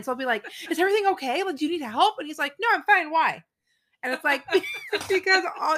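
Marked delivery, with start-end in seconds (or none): 0.7-2.1 high-pitched anxious questioning; mock concern, pitched way up; worried checking. Worried wife checking on griping husband. 2.6-3.4 perfectly fine. Retold or imitated. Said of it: "he's perfectly fine".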